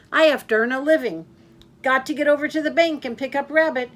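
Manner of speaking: a cheerful blabbermouth who never stops; loud chatty Yak. Acted. Yak brushing off Walrus.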